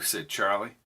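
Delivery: usual speaking voice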